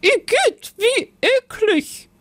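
shrill high disgusted cry. A narrator's reading. The cooking witch tastes Lisbeth's food, disgusted cry. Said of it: "rief die Hexe".

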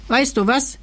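a hint of a giggle, rising; giggly. A narrator's reading. Little creature springing an idea on the king, giggly. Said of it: "kicherte das Kerlchen".

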